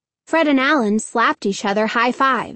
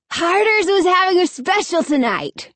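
raised, declaring voice; loud declaration; 7 semitones higher than usual. A narrator's reading. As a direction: announce it loudly to the street. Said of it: "Alan declared loudly".

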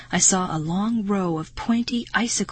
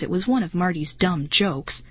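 ordinary delivery, nothing marked

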